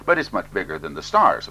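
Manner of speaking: usual speaking voice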